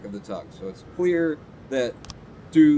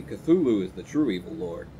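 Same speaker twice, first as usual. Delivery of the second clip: usual speaking voice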